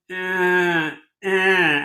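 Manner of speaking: exaggerated nasal "eh" noises; Miriam. Upstairs neighbor's habitual noise, exaggerated nasal imitation. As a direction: whine out two drawn-out ehs like the neighbor. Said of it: "she always goes".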